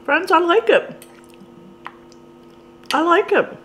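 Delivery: chewed words between bites; talking while chewing. Real, not acted. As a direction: mouth partly full of food. Seen 0: bit beef at -7; jaw working, lips closed, fork in hand.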